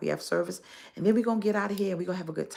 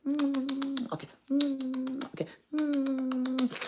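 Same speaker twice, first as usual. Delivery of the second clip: kiss sounds; 10 semitones higher, much slower than usual. Pretend. Toy duck kissing ladies.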